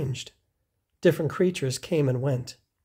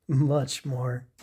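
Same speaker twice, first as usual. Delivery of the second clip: plain reading voice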